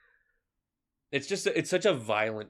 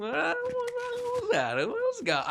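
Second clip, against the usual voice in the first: mock squeak. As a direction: stretch it into a cartoon squeal. Mock whine after losing fight.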